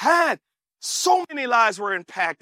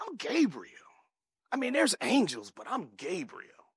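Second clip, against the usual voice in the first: upset angel; 3 semitones lower, 9 dB quieter than usual. Acted. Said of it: "the angel got upset".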